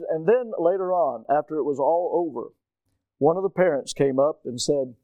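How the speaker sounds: ordinary delivery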